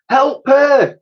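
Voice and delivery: high, loud urgent call. Acted. Elsie calling to the old soldiers, urgent call. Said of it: "called out Elsie".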